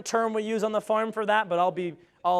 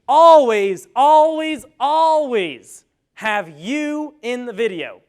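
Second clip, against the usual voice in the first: each always louder and punched hard, the last stretched slow; emphatic repetition; 4 semitones higher, 9 dB louder, much slower than usual. Acted. Presenter hammering tip two at the audience, loud and insistent. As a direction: drive always, always, always into them.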